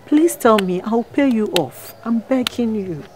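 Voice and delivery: ordinary delivery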